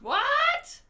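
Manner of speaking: shouted, high and loud; loud sharp voice. Real, not acted. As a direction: yell it sharply. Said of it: "Sorry for shouting so loud".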